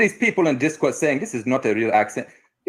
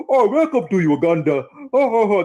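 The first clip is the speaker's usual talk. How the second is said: higher, louder mock-Ugandan caricature; big stupid voice; 9 semitones higher, 4 dB louder than usual. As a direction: put on a big dumb booming welcome. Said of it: "big stupid voice like that".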